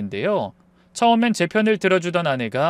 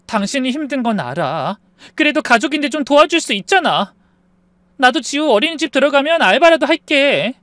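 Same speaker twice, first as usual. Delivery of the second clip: high, pleading, growing louder; tearful wife; 8 semitones higher, 5 dB louder than usual. Acted. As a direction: beg your husband through tears. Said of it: "그렇게 아내의 눈물에".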